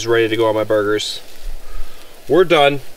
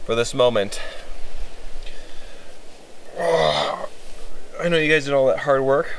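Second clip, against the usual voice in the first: ordinary talk, nothing marked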